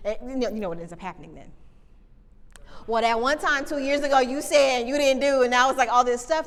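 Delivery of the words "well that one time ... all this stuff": louder, higher, reproachful complaint; complaining accusation. Retold or imitated. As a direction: throw it back as an accusation. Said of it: "someone's gonna be like".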